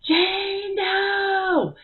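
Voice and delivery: high, loud call of a name; retelling a sudden shout of a grandmother's name; yelled name. Retold or imitated. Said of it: "but I yelled out".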